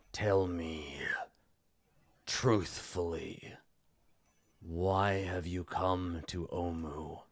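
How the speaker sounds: slow, hushed interrogation; imperious villain. Acted. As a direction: demand the truth with long, ominous pauses. Game master voices the NPC.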